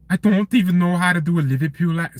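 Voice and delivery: high-pitched attempt at Scouse; badly faked Liverpool accent. Shown as a demonstration. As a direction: try a shaky Scouse impression. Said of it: "to do impressions".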